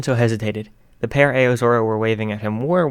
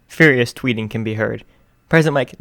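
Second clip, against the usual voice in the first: usual speaking voice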